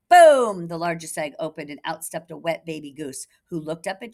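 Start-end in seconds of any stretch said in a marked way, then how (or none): 0.1-0.7 boom sound. Acted. Egg bursting sound effect.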